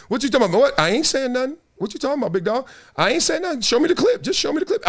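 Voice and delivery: high-pitched, quick protest of innocence; mock defensive denial. Answering the hater who came up talking trash, mock defensive.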